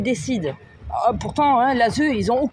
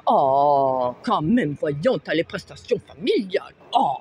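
lower, mocking imitation of people scolding her; mocking the scolders; 6 semitones lower than usual. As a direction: parrot the advice back with scorn, pitched down. Mocking what others tell her.

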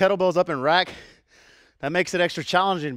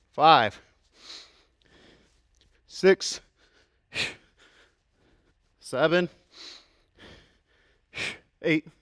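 counting out reps; much slower than usual. Real, not acted. Coach counting reps during set.

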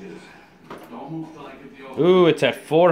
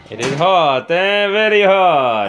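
louder mock Italian accent; playful Italian chef voice, slightly louder; 3 semitones higher, 6 dB louder than usual. Warning about the hot pizza in a mock Italian accent. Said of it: "my Italian accent".

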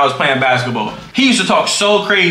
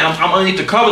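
ordinary talk, nothing marked

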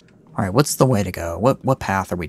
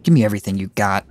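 ordinary talk

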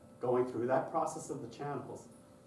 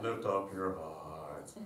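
low, very slow spoken drone; drawn-out low liturgical monotone; monotone bishop; 7 semitones lower, 3 dB quieter, much slower than usual. Retold or imitated. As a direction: imitate a bishop droning the liturgy. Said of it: "everything in his speaking voice".

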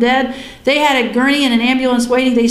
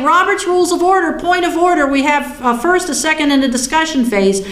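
higher-pitched mock objection; retold annoyance, pitched up; ticked off; 5 semitones higher than usual. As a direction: protest indignantly, as if raising a point of order. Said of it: "I was ticked off".

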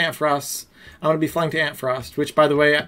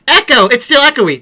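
loud; 6 semitones higher, 9 dB louder than usual. Real, not acted. Hearing echo in room.

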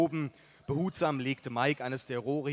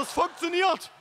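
elated shout; 5 dB louder than usual. Acted. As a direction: crow with delight. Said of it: "rief mein Vater begeistert". (begeisterter, hoher Jubelschrei)

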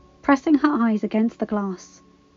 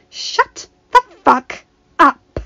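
furious slow hiss; 6 semitones higher, 9 dB louder, much slower than usual. A narrator's reading. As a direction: spit each word out in rage. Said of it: "hissed Marjorie".

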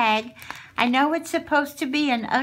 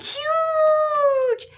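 delighted squeal; 3 dB louder than usual. Real, not acted. Delighted over a gift.

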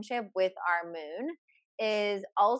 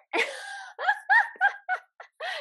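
high, loud burst of laughter; 3 dB louder than usual. Real, not acted. Cohost laughing at herself, high and full.

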